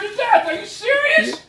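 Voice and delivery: very high, incredulous shriek; real screaming. Real, not acted. Parent outraged at being called frightening, screaming. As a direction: yell in outraged disbelief. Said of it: "You're screaming".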